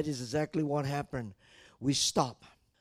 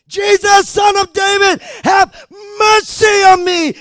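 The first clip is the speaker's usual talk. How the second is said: very loud, high-pitched cry; louder shouted plea; 20 dB louder than usual. Acted. Blind man calling to Jesus again, louder shouted plea. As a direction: shout it over those silencing you. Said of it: "even louder".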